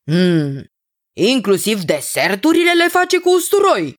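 doubtful hum, then high questioning voice; unsure of himself. A narrator's reading. King asking about garlic in desserts, unsure of himself. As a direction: ask hesitantly, pitch climbing and held. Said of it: "întrebă nesigur pe el".

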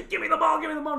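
put-on high, loud childish demand; mock hovering fan. Mocking a fan looming over kids, high and loud demand. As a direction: whine for the ball like a spoiled kid. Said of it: "he's like".

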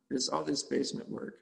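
ordinary talk

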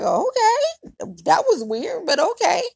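bemused okay. Retold or imitated. Reacting after ex hung up.

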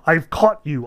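mildly pleased to have caught him out; childish delight. A narrator's reading. Ivan catching the devil out, lightly gleeful. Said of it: "Ivan cried, with an almost childish delight".

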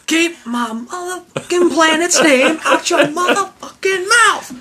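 high laughing movie quote; half-laughing hammed-up action hero; [name] impression. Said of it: "Can I be [name]?".

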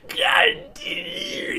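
very high, hugely drawn-out seething cry; imitated strangled rage. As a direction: choke the curse into a long strained squeal. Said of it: "that's just rage".

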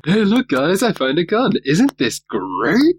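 high, loud giddy excitement; mock excited. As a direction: gush like a kid with a toy. Voicing Sulu excited about gun.

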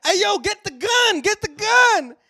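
high, loud mock yelling; panicked shout. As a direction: yell for the gun in a panic.

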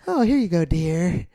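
friendly waitress. Retold or imitated. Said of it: "They call you dear".

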